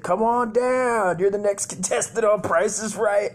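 loud, high, drawn-out announcer call; game show announcer. Retold or imitated. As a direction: summon the next contestant like a TV announcer. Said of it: "the fat dude that announces".

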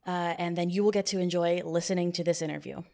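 ordinary talk, nothing marked

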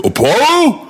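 high, surprised Rocky; mock Rocky. Rocky surprised that Apollo is calling, high and questioning. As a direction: ask the name in high surprise. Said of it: "Rocky goes".

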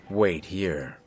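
low-voiced, slightly stern captor; captor's order. A narrator's reading. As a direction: give a calm, restrained order. Captor orders the prisoner to wait.

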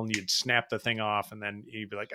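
usual speaking voice

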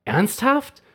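put-on boy scolding a stubborn toothbrush, a touch of anger; annoyed scolding. A narrator's reading. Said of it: "schimpft Semi".

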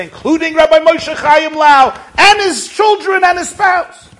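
high, loud, sustained cry; impassioned testimony. Retold or imitated. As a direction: cry out each phrase with full force. Old man's testimony erupts in shout.

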